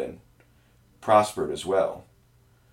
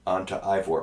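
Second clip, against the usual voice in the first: ordinary talk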